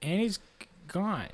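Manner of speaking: soft playful mourning; hushed mock echo. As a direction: echo the loss in a hush. Co-host echoes the lament.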